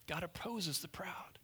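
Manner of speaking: soft, near-whispered repeat; hushed echo. Shown as a demonstration. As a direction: let it sink in, almost under your breath.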